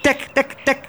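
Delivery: high, short bird alarm notes; starling alarm call. A narrator's reading. Starling alerts other birds while flying on, alarm call. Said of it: "sloeg alarm".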